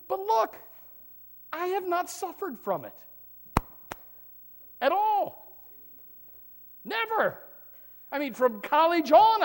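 high and loud, crying it out; preaching shout. Acted. Pastor shouting his testimony to the church, high and loud. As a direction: belt it out to the back pew.

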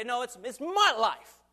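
high, loud stubborn protest; proud insisting. Retold or imitated. Proud people shouting at God that their life is theirs. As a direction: insist like a proud person clinging to his life. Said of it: "many times we say".

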